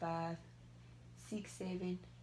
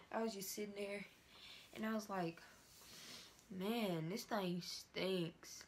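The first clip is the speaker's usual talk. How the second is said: speaking with tight facial skin; little cheek movement; through a stiff face mask; 6 dB quieter than usual. Real, not acted.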